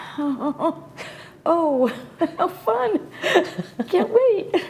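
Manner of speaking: high, drawn-out sarcastic delight; oh joy, said with dread; mock enthusiasm. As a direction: feign excitement about something you dread, slowly. Mock enthusiasm at dreaded blind date.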